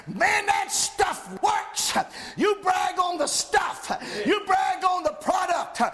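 loud, high shouted preaching; preaching at full force. Acted. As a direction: drive it home like a fiery preacher. Pastor exhorts congregation.

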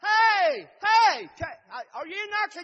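loud, high holler at a door. Acted. Savior calling through a closed door, hollering. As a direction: call out hard to someone inside. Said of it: "maybe if I holler".

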